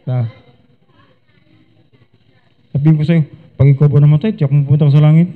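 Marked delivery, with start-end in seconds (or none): 3.6-5.3 flat, abrupt mock demo of the wrong way; mock tactless soul-winner asking a stranger; blunt abrupt question. Shown as a demonstration. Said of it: "ikaw matatawa lang".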